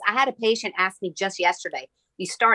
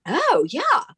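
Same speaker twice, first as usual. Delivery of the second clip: dawning realization; 9 semitones higher than usual. Retold or imitated. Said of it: "And she was like".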